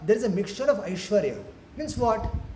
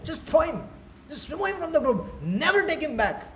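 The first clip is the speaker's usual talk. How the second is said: raised-pitch, mildly outraged rejection; acted annoyance, pitched up; indignant dismissal; 7 semitones higher than usual. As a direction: throw him out of the gang. Playmates rejecting Krishna.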